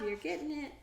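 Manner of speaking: ordinary delivery, nothing marked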